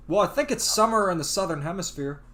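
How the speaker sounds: ordinary delivery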